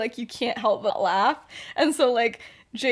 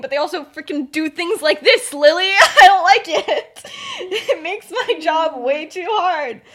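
loud, high complaint breaking into laughter; exasperated outburst; 7 semitones higher, 11 dB louder than usual. Retold or imitated. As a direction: complain at full volume, drawing out the words. McGonagall's exasperated outburst, acted.

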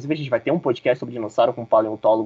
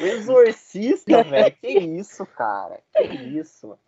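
incredulous; 8 semitones higher, slower than usual. Real, not acted. Host shocked at contestant's answer.

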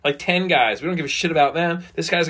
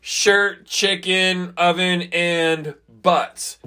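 slow, drawn-out list of words; contestant's answer; 4 semitones higher, much slower than usual. Retold or imitated. Contestant slowly reading out his answer. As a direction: read each word out slowly, one by one. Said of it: "this guy was like".

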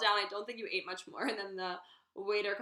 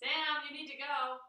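usual speaking voice

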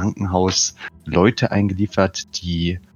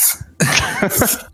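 high breathy laugh; 11 semitones higher than usual. Real, not acted. Joke about city name falls flat.

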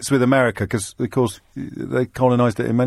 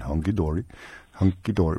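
put-on Dutch accent; 9 semitones lower, 7 dB quieter than usual. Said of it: "I'm trying to say it in a Dutch accent".

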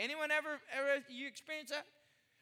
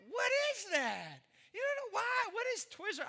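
mock bafflement; 8 semitones higher than usual. Pastor to church about Twizzler straws, baffled higher. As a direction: sound truly baffled.

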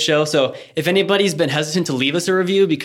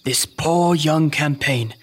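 somber nature documentary. Acted. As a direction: mourn the helpless creature. Documentary narrator pities prey.

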